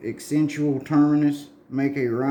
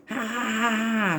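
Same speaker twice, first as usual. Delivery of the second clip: villain laugh; 10 semitones higher than usual. Retold or imitated. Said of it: "snort, giggle".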